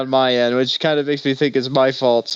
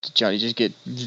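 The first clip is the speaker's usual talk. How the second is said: usual speaking voice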